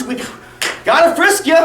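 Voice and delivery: normal talking voice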